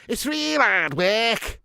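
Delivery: put-on loud, drawn-out, thick Scouse accent; proper Scouse. Imitating Scousers who must repeat themselves, thick Scouse accent. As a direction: exaggerate a hard-to-understand Scouser, high and slow. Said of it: "I've met some proper Scouse".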